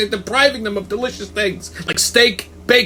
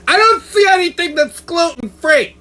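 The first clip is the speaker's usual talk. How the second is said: imitating a picky customer, pitched up; mock fussy woman; 10 semitones higher than usual. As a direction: play a picky diner complaining. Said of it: "she goes".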